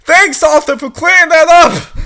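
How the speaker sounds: high, loud mock gratitude; sarcastic thanks. Narrator mockingly thanks Arthur for explaining. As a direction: thank him with heavy sarcasm.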